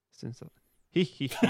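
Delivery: sinister giggle. Acted. Reading the stalker's part, a hammed-up sinister giggle. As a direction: giggle like a pantomime villain. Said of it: "sinister laugh".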